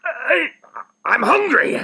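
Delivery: usual speaking voice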